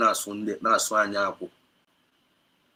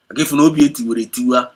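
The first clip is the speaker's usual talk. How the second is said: impassioned outburst; 4 semitones higher, 12 dB louder, faster than usual. Real, not acted. Impassioned address to brother.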